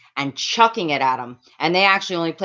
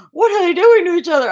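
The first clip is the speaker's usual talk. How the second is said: much higher, louder and fast shocked cry; retold horrified cry; 13 semitones higher, 7 dB louder, faster than usual. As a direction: shriek it in disbelief at the mosh pit.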